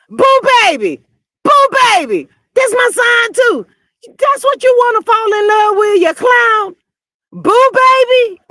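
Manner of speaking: high, stretched-out mock cheer; mocking holler. Host mocking his love interest's sign, high mocking holler. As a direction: holler it like a ridiculous sign-waver.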